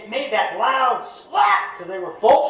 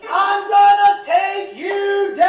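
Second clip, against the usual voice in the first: drawn-out, sing-song war cry, much higher; slow, high mock yell at full lungs; mock battle scream; 9 semitones higher, much slower than usual. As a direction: belt it like a hammed-up charge. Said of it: "screams at the top of his lungs".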